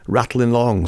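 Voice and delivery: usual speaking voice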